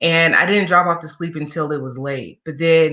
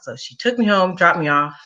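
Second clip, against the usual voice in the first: ordinary talk, nothing marked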